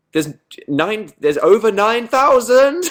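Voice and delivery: high, loud, drawn-out exclamation; mock-dramatic shout. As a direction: yell the number with hammed-up amazement. Mock-dramatic announcement of the viewer count.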